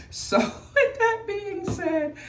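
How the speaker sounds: high, slow laugh through the words. Real, not acted. Vlogger to viewers, laughing as she changes subject. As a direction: giggle your way into the next topic.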